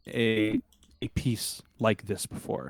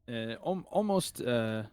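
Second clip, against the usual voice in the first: normal talking voice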